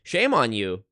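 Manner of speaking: ordinary talk, nothing marked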